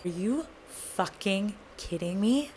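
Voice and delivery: very soft, slow, word by word; quiet outrage with long gaps between words; stunned disbelief. Retold or imitated. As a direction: drop to a hushed, incredulous question. Retold reaction to his confession.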